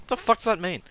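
loud, high-pitched exasperated question; hammed-up baffled outburst; mock bafflement. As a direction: demand it in exaggerated confusion. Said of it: "and I'm like".

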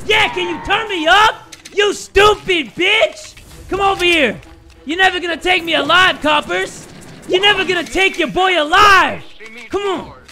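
high, loud outlaw yelling; defiant shouting. Acted. Gamer taunting coppers in the game, defiant shout. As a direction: yell defiantly at the enemy.

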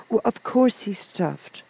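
put-on high, slow, exasperated girl; still angry. A narrator's reading. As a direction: answer crossly but softly, as a child. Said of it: "who was still angry".

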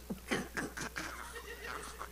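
hushed, breath-only snickering; stifled laughter. Retold or imitated. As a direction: hold in a laugh while hiding. Stifling laughter while hiding.

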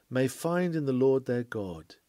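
ordinary delivery

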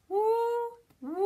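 long, rising siren wail; imitated siren whoop; fire engine siren. Shown as a demonstration. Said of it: "The fire engine's on the way".

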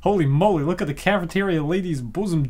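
ordinary talk, nothing marked

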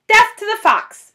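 high, loud battle cry; shouting. Acted. Bunce on his tractor shouting a loud battle cry. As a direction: roar it like a war cry. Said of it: "shouted Bunce".

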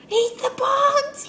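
slow, high and loud cheering on; excited high urging. Real, not acted. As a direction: egg the frog on, drawn out and high. Urging the frog.

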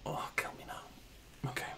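slow despairing whisper. Real, not acted. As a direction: breathe it out, defeated. Long restart after death.

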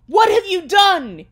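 booming, high theatrical shout; melodramatic cry. A narrator's reading. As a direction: cry out in overblown horror. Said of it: "he started to cry wisely".

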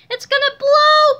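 high, drawn-out shout of warning; alarmed cry. A narrator's reading. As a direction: shout a warning of imminent explosion. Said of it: "cried Harold".

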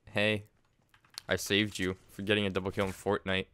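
ordinary talk, nothing marked

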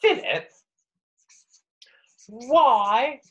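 high, posh Queen voice. Acted. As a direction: sound like the Queen asking. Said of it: "I'm sounding a bit more like the Queen now".

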